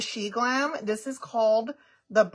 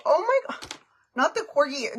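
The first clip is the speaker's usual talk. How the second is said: high, excited oh my God; delighted squeal; 6 semitones higher, 4 dB louder than usual. Real, not acted. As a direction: squeal at the cute corgi. Reacting to corgi picture.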